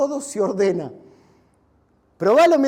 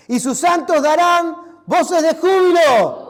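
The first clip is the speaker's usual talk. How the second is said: slow, much louder joyful shout; 4 semitones higher, 10 dB louder, slower than usual. Shown as a demonstration. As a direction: cry it out like praise filling the church. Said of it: "Para, no me escucharon". (gritar con júbilo, lento y fuerte)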